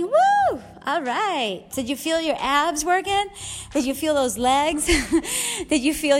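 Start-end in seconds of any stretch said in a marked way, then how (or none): 0.0-0.6 high joyful woo; excited whoop. Real, not acted. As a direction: cheer at the end of a set. Finishing the last rep.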